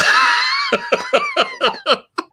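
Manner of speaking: real helpless laughter; burst of laughter. Real, not acted. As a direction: crack up at the memory. Reacting to absurd teacher story.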